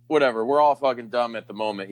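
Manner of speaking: ordinary talk, nothing marked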